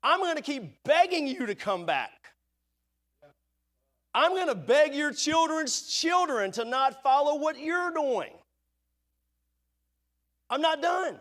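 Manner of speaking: God begging his people not to give up, earnest; voicing God pleading. Preacher voicing God begging his people. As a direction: plead like a father who won't quit.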